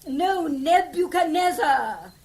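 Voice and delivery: slowly, each syllable of Nebuchadnezzar spelled out; slow and clear. Acted. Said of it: "No, no, no".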